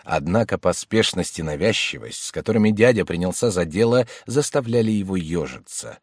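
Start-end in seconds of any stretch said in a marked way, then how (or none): none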